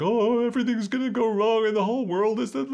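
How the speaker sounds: high, far-off frantic worrier voice; panicky inner terrorizer. Retold or imitated. Coach voicing his inner terrorizer, high and frantic.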